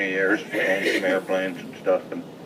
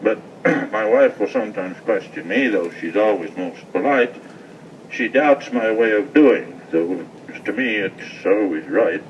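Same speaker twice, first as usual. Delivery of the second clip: rhythmic cowboy poem reading; poem recitation; 5 dB louder than usual. Acted.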